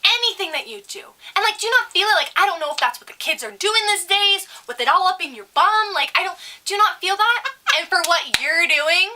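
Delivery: high-pitched imitation of a nagging grandma; mock an appalled older woman going on and on; scolding older customer. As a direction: keep on lecturing, high and insistent. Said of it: "she continues".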